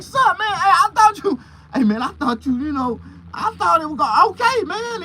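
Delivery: high, loud panicked yelping; panicked pleading. Retold or imitated. Pleading with the angry officer, high and panicked. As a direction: beg your way out in a panic. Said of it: "I was like".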